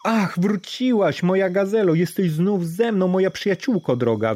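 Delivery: joyful exclamation. Acted. Daraj welcoming his gazelle home, high joyful cry. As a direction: greet a lost friend with joy. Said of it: "wykrzyknął z radości".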